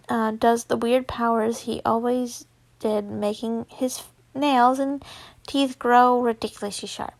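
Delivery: ordinary delivery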